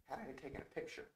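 very soft, hushed voice. Real, not acted. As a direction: drop right down in volume. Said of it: "I don't know why I'm speaking softer".

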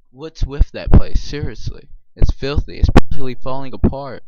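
loud exasperated telling-off; mock scolding. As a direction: yell it at him like the place disgusts you. Reading a scolding line aloud.

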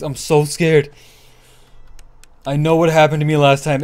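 ordinary delivery

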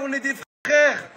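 ordinary delivery

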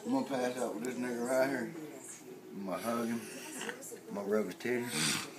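ordinary talk

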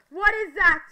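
loud alarmed repeat of the question; startled shout. Real, not acted. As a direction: yell it out, suddenly spooked.

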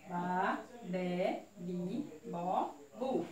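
each syllable said separately, evenly spaced; measured read-along syllables; syllable drill. Shown as a demonstration. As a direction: read the syllables out one by one for children. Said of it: "vamos ler junto com aqui".

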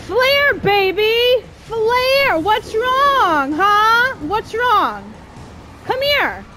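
high, loud, cocky jeering; taunting shout. Real, not acted. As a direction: yell a gloating challenge at the enemy. Taunting enemy while using flare.

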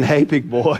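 lightly voiced low tease; a hint of a sultry coo; Rosanna as temptress. Pastor imagining his wife teasing him, lightly sultry. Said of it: "her saying".

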